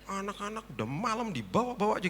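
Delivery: lightly imitated high-pitched grumble; grumbling. Housekeeper carrying children indoors, grumbling about the late night. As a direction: grumble in mock complaint. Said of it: "Sambil ngedumel gendongnya".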